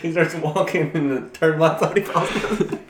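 high, loud, laughing retelling; excited. Real, not acted. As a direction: tell it through laughter.

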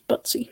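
"Butsy" as BUT-see; two-syllable "Butsy", stressed at the front; looked-up pronunciation. Shown as a demonstration. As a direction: say "Butsy" with a short first vowel and final ee. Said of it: "how it's supposed to be pronounced".

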